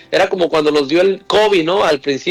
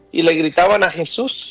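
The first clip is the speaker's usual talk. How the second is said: ordinary talk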